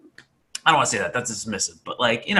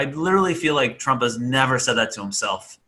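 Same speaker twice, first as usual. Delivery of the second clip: ordinary delivery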